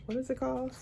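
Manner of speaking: whispered aside; whispering. Real, not acted. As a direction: whisper it to yourself. Whispered aside while filming.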